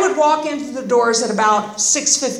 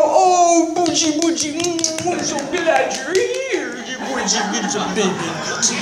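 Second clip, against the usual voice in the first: mock baby-talk cooing, sinking in pitch; cooing sympathy. Imagined group welcoming her back, cooing and fussing. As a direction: coo over them like a baby. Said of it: "waited for you all to go".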